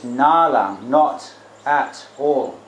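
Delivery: "Nalang", then NOT, AT, ALL slowly spaced; emphatic. Shown as a demonstration. Teacher quoting the Buddha's meaning, slow and emphatic on "not at all". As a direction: weigh each word of "not at all" separately. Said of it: "That's not emphatic".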